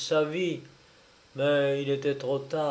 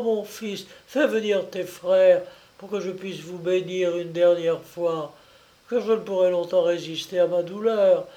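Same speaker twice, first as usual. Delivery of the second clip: high, pained voice of a poisoned mother; anguished old mother begging for help; suffering dying mother; 5 semitones higher, 4 dB louder than usual. Acted. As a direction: plead through the pain, pitched up.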